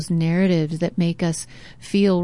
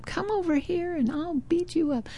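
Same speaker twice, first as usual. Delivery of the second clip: the threat returns much softer; faint echo; 9 semitones higher, 8 dB quieter than usual. Retold or imitated. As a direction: echo it back from far away. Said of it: "So he's hearing the echo".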